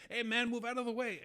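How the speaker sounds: retold holler from an electric cart; imitated yell. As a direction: shout it like a gardener stuck behind you. Gardener yelling from a cart.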